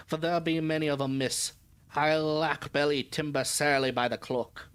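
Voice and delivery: put-on old sailor's broad dialect accent; thick country accent, read as written in dialect; thick rustic accent. Old sailor dismissing local legends to a young lady, thick rustic accent. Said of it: "Thick accent on that guy".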